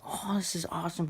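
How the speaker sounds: high mock scream of joy; happy scream. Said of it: "all happy, I'm screaming".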